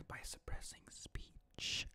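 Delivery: slow, hushed whisper; slow conspiratorial whisper; dramatic whisper. Acted. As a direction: lean in and whisper it like a secret. Dramatic asides on suppression.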